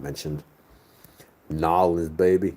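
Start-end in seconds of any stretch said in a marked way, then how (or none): 1.5-2.5 put-on Nolans baby in a light Southern twang; a hint of a New Orleans drawl on Nolans baby. Northerner playfully imitating New Orleans locals, light Southern twang. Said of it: "They say "baby" every time".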